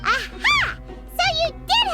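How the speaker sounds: high-pitched cry of discovery; triumphant gotcha. Acted. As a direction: pounce on it triumphantly. Catches friend's hidden motive.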